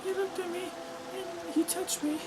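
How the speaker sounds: put-on small, high, soft girlish voice; timid girl character. Girl confides to the priest about a man, timid. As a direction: speak up high and softly, fragile. Reading the girl's lines in a story.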